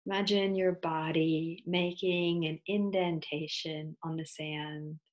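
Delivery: unhurried, soothing yoga nidra guidance with long pauses; guided meditation. Acted. Teacher leading listeners into yoga nidra, guided meditation voice.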